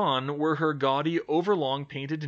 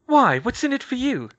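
much higher, louder demand; 11 semitones higher, 9 dB louder than usual. Acted. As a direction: press her hard. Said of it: "she demanded".

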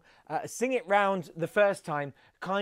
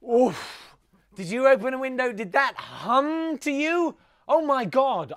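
loud, high recoil at a stink; disgusted surprise; 6 semitones higher, 6 dB louder, slower than usual. Real, not acted. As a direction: react as if hit by a foul smell. Reacting to a bad smell.

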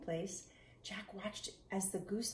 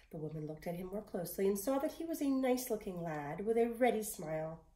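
ordinary delivery, nothing marked

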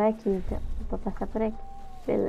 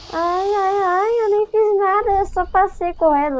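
scared whining; 9 semitones higher, 3 dB louder than usual. Real, not acted. Fleeing a creature in game.

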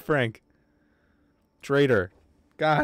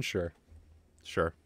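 ordinary talk, nothing marked

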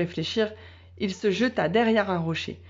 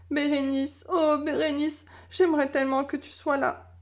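crying dog; 6 semitones higher than usual. A narrator's reading. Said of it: "Il se mit à pleurer".

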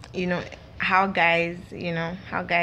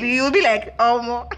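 laughing; 6 semitones higher, 4 dB louder than usual. Retold or imitated. Mocked reaction dissolves into laughter.

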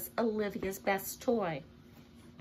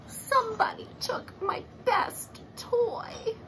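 slow, breathy, crying whine; a child on the edge of tears, dragging the words; whimpering complaint; 12 semitones higher, slower than usual. Acted. Olivia lamenting her stolen toy, slow tearful whine. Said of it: "She is not happy".

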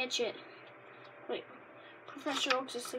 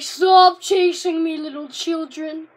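voicing a Pokemon; 3 semitones higher, 16 dB louder, slower than usual. Kid voicing a card Pokemon telling children off, loud and slow. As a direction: boom it out like a grumpy cartoon critter. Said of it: "He's like".

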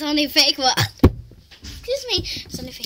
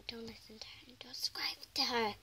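whisper; 3 semitones lower, 14 dB quieter, faster than usual. Real, not acted. Whispering to viewers about sister.

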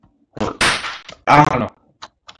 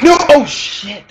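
high-pitched cry of alarm; startled high outburst; 12 semitones higher, 5 dB louder, much slower than usual. Real, not acted. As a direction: yell out in sudden dismay. Mishap in the game.

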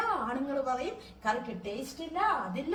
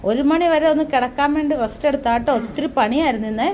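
teasing call; 13 dB louder than usual. Real, not acted. Teasing husband resting, calling loudly.

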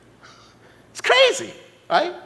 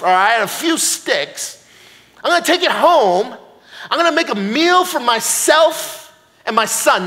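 feisty Jersey widow, raised pitch and punchy; Jersey accent; 5 semitones higher, 4 dB louder than usual. Acted. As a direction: rant indignantly in a Jersey accent. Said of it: "she was from Jersey".

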